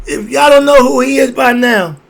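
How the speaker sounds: preacher-style loud cry; loud warning. Real, not acted. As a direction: shout it out to the audience. Warning peaks in the rant.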